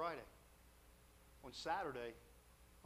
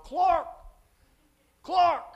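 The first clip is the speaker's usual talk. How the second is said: high, very loud call of a surname; yelling out; 15 dB louder than usual. Retold or imitated. Student yelling to a classmate by the side door, loud. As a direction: yell for someone across the building. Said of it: "he yells out".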